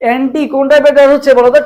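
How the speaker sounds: ordinary delivery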